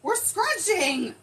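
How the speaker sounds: high, loud, stretched-out exclamation; excited announcement. Real, not acted. Host answering what is happening, excited announcement. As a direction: belt it out slowly with glee.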